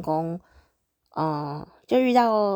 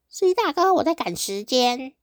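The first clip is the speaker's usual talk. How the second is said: mock hurried passenger; 6 semitones higher than usual. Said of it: "你千万不要跟司机说".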